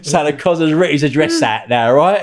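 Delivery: high and loud, breaking into laughter; laughing. Real, not acted. Recalling the copper asking for an autograph, laughing hard. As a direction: cackle through the words.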